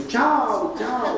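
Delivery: "Tchau, tchau" sung out high and playful, laughing; Italian-style tchau. Shown as a demonstration. Speaker jokes about how to say goodbye, playful and high. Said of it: "tem que dizer assim".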